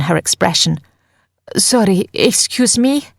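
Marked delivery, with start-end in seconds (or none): 1.6-3.1 raised, high-pitched repeat; a little louder. A narrator's reading. Nika trying to get Phil's attention, higher and more insistent. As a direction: call out higher, ending on a questioning rise. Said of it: "she repeats, louder now".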